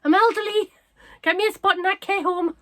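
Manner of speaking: high, loud mock wail of an old dog; elderly dog act. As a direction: moan dramatically as the worn-out pet. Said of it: "she comes home and she's like".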